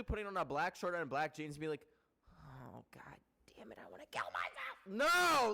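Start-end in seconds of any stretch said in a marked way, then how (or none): 2.3-4.9 low, soft, hammed-up gloom; sarcastic muttered misery; mock despair. Mimicking kid supposedly depressed by clothes. 4.9-5.5 high, loud outburst; sudden exasperated shout; emphatic refusal. Real, not acted. Rejects the idea just mocked.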